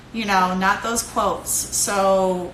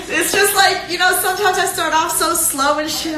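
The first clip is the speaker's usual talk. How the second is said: laughing self-mockery; 8 semitones higher, 4 dB louder than usual. Real, not acted. Laughing at himself.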